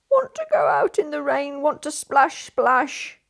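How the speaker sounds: put-on a sulky toddler demanding to go out; naughty little sister grumbling that she wants to splash in the rain; crossest growliest voice. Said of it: "in the crossest and growliest voice".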